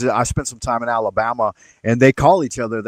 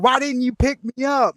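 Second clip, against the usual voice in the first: much higher, hurt mock girlfriend; mock girlfriend complaint; 11 semitones higher than usual. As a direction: play her asking, pitched well up. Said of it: "she's like".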